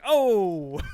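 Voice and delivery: very high, loud re-enacted holler; big comic whoop; loud exclamation. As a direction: belt it out in mock triumph. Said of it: "I was like".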